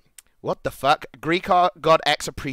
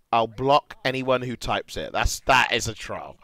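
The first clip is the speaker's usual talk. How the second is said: ordinary talk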